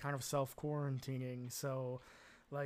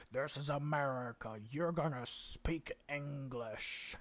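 worst kind of American. Retold or imitated. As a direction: drag out a mock-bossy American order. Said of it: "be the worst part of America".

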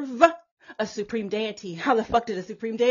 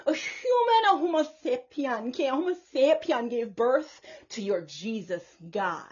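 normal talking voice

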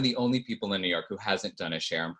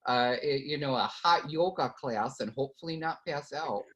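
put-on chatty, higher Midwestern accent; put-on Midwestern mom voice; 4 semitones higher than usual. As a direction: riff as Kathy, a Midwestern mom. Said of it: "Western mom who I think people liked".

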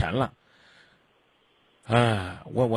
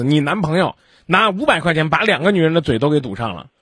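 usual speaking voice

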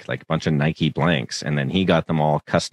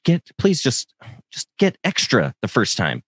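higher pleading voice; mock plea; 7 semitones higher than usual. Patient begging nurse for extra blood, mock plea. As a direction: beg the nurse lightly. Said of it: "pleading with nurse".

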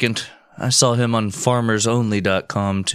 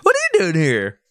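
Chris Hansen; 7 semitones higher than usual. Retold or imitated. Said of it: "Chris Hansen sitting there".

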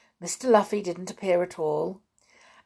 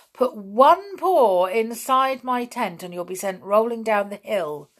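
louder, higher stern threat; stern warning; 5 semitones higher, 6 dB louder than usual. Acted. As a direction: scold the dog off. Dick warns off the dog.

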